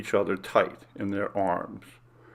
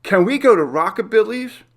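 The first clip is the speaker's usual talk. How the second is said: put-on much higher, louder kid voice; young boy; 11 semitones higher, 7 dB louder than usual. Oldest son asking his dad for burgers, high pleading kid voice. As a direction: ask like an eager boy. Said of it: "Bobby, their oldest, asked".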